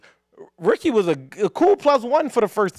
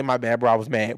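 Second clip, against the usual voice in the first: retold sheepish excuse; 7 semitones lower than usual. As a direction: drop it low like a muttered excuse. Quoting teammate's excuse walking off.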